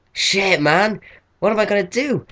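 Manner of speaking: a rush of rising alarm; retold panic. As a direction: replay the moment of dread at work. Panic after a friend comes out.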